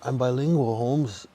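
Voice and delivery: high, put-on movie-character voice; Next Friday quote. Streamer quoting Next Friday, high put-on voice. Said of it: "He's like".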